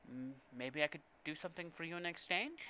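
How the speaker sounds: soft, hesitant bargaining; tentative offer. Acted. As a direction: offer a favour quietly, feeling your way. Player timidly bargains with the angry dealer.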